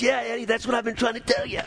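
lightly voiced father chiming in, quick and high; dad. Son retells his dad chiming in, lightly voiced, quick and high. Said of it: "my dad goes".

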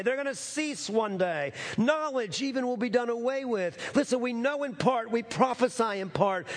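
fervent sermon climax; impassioned preaching. Acted. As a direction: preach it out to the whole church.

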